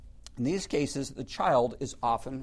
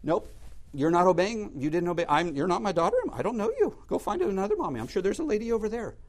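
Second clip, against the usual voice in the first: put-on raised, louder voice of a mom disowning her child; firm, higher-pitched mock rejection; acted stern mother; 7 semitones higher, 5 dB louder than usual.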